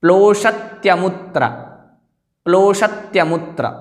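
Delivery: प्लोषत्यमुत्र with "pl" joined, long o; careful pronunciation. Shown as a demonstration. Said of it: "दोबारा उच्चारण कर लेते हैं".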